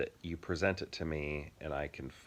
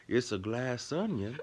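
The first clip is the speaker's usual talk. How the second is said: put-on Southern accent; 6 semitones higher than usual. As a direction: imitate a Southern movie character's accent. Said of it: "you're doing that as a Southern accent".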